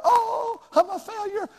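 high, mock-hurt sigh; mock wounded whimper. Preacher imitating a hurt staff member, mock wounded. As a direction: play the staff member who's crushed by criticism. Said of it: "they'll cut their heart".

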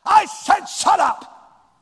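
high, slow, loud yell; yelled order. Shown as a demonstration. Preacher demonstrating how parents yell at a child, slow loud yell. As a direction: scream it like an angry parent. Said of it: "we have to yell at them".

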